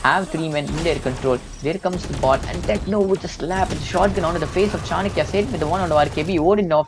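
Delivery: fast, climbing play-by-play call; esports caster. Acted. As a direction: hype it up as the play unfolds. Rising live play-by-play.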